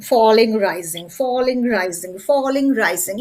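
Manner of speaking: each falling said with a falling tune, each rising with a rising tune; falling rising demo. Shown as a demonstration. Teacher showing falling and rising tunes to the class. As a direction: sing falling down and rising up, word by word. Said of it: "So intonation means".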